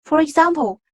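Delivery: ordinary delivery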